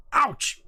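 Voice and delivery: sudden high-pitched "ouch"; startled pain yelp. Acted. Character bumped while chatting, acted high yelp.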